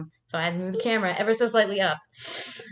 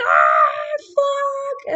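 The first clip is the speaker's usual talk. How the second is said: very high, loud re-enacted shriek; mock horrified scream; 7 dB louder than usual. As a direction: scream it as in the retold panic. Reacting to crab moving in pot.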